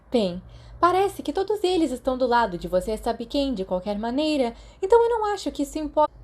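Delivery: a student reasoning aloud over the paper; worried schoolgirl. A narrator's reading. Mary voiced by narrator. (estudante preocupada, voz mais aguda)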